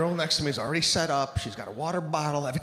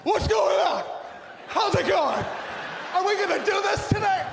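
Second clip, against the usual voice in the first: fake positive gym-goer; 13 semitones higher than usual. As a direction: gush like a fake-cheery regular. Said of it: "everybody's fake positive".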